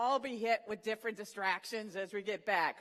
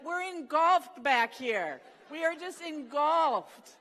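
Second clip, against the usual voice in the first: loud, slow, higher dramatic complaint; hammed-up teammates wailing from behind; mock outrage; 7 semitones higher, 9 dB louder, slower than usual. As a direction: wail it like you're suffocating. Teammates complaining about the smell.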